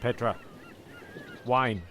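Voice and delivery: ordinary delivery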